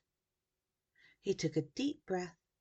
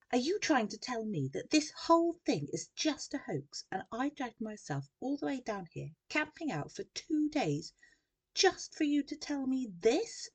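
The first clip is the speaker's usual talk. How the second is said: high, raised, outraged voice; angry yell; 8 semitones higher, 4 dB louder than usual. A narrator's reading. Journalist confronting Sam over the hoax, yelling angrily. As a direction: rant in outrage, stressing each grievance. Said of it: "she yelled angrily".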